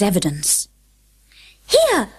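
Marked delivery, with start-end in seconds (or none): 1.7-2.1 much higher eager call; Alice answering the Rabbit's call; Alice crying out. A narrator's reading. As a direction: jump up and answer. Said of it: "cried Alice".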